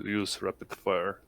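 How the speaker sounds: usual speaking voice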